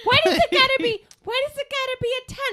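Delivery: very high imitated New York therapist; New York accent. Therapist talking a client out of perfectionism, high New York accent. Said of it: "she has a New York accent".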